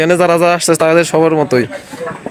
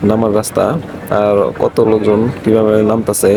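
vlog narration; 9 semitones lower than usual. Acted. Addresses viewers about the trail.

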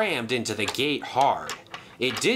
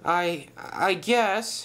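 slow, halting, higher voice; hesitant murmur; 5 semitones higher, much slower than usual. Acted. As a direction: stammer it uncertainly. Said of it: "he murmured quietly".

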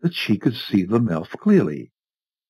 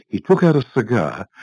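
plain reading voice

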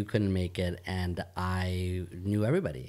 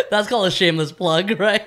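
loud, high, laughing through the words; 11 semitones higher, 11 dB louder than usual. Real, not acted. Co-host owning his shameless podcast plug, laughing. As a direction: crack up while explaining your own joke.

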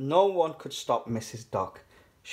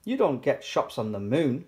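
plain reading voice

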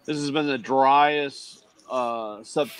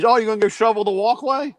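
a lightly raised mock wife voice; mimicking wife; 9 semitones higher, 3 dB louder than usual. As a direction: tease it the way she said it. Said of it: "The wife goes".

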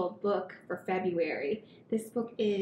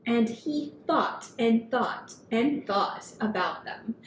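put-on gentle read-aloud for kids; storybook reading. A narrator's reading. Reading picture book to children.

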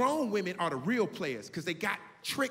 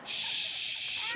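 shushing. Retold or imitated. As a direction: hush him gently. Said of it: "And she was like".

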